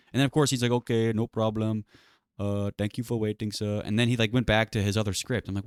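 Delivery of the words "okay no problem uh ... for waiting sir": put-on slow, polite Indian-accented reply. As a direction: imitate an unbothered agent with an Indian accent. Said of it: "racist Indian accent".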